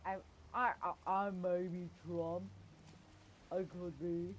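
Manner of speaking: brash half-hearted Trump voice; Trump impression. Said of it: "is that your best Trump accent".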